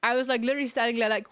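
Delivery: ordinary talk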